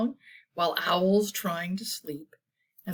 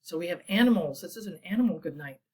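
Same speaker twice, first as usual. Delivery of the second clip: usual speaking voice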